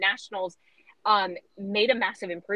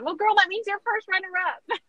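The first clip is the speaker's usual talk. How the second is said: higher, sassy teasing line; teasing the first runner-up, higher playful sass; playful tease; 9 semitones higher, 3 dB louder than usual. Retold or imitated. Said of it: "And I said".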